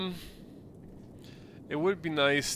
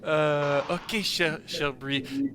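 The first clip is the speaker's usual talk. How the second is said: usual speaking voice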